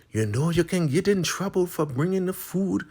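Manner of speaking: high put-on voice; warning Marva. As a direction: caution sternly. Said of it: "She's like".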